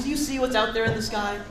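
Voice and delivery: usual speaking voice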